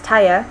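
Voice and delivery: tire said taya, no R, ending in a schwa. Shown as a demonstration. Teacher demoing British taya. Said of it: "tire is pronounced taya".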